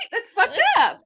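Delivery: amused exclamation. Real, not acted. Laughing at own people pleasing.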